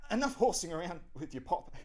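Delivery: acted strangled dad, still cheerful; choking. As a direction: force it out while being throttled. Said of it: "while choking".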